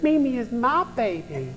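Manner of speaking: put-on high-pitched little-kid echo; small child's mimicking voice; imitating toddler granddaughter. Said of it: "She went".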